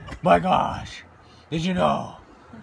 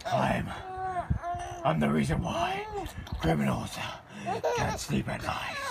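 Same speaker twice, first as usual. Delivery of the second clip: put-on softer Batman voice to the baby; 5 dB quieter, slower than usual. Dad playing Batman for baby Riley, hushed Batman voice. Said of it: "he's talking like Batman".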